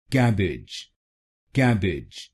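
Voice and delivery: कैबेज said kebedge, open e first; whole word kebedge again at normal pace; correct pronunciation. Shown as a demonstration. Teacher repeating the full word after the drill. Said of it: "तो बनेगा".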